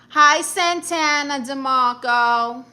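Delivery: loud, slow, stretched-out hello; calling out a greeting. Real, not acted. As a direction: greet a new arrival loudly, stretching the words.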